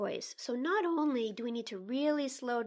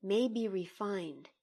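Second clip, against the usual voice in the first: poem reading; 2 semitones lower than usual. Acted. Colleague asked to read the poem, measured poem reading. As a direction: read the poem with care, line by line.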